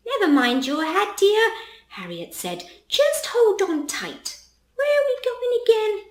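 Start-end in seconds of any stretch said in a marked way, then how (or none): 0.1-1.5 put-on high, light old grandma voice. Grandma soothing Charlie about his hat, in a high grandma voice. 2.9-6.1 grandma voice. Acted. Grandmother forgetful on goose.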